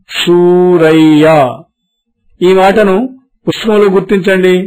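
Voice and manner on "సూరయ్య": సూరయ్య drawn out very slowly, య held firm; slow model word. Shown as a demonstration. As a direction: say సూరయ్య slowly for pupils to repeat. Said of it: "మళ్ళీ చెప్పండి".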